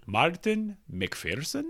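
soft, slow, drawn-out soothing name call. Pretend. Host introducing the emailer late at night, nice and soothing. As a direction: say the name gently and slowly, as if to soothe. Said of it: "that was very nice and soothing".

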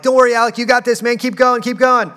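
high, loud encouragement to a tiring swimmer; pep him up as he sinks; cheering Alec on. Retold or imitated. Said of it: "And I'm like".

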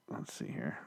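half-voiced murmur while searching; under-breath mutter. Real, not acted. Muttering to himself while looking something up online, whispered. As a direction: whisper it to yourself, distracted.

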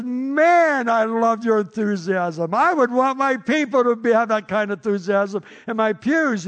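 high, loud, exuberant praise; retold gushing enthusiasm. Preacher praising the wild fan's enthusiasm, excitedly.

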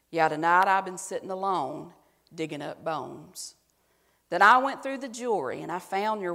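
hammed-up Southern recital of country lyrics; exaggerated Southern accent. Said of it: "say it in an exaggerated Southern voice".

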